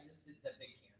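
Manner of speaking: hushed, breathless oops; whisper. Real, not acted. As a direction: whisper it so no one hears. Realises a camera mistake.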